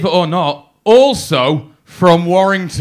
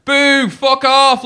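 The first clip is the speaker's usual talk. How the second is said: drawn-out, higher heckle; heckler; 7 semitones higher, much slower than usual. Retold or imitated. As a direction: boo slowly like a lad at the back of the crowd. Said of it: "this lad at the back was like".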